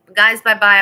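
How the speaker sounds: loud playful farewell; mock goodbye. Streamer to chat, loud mock farewell. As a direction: pretend to storm off.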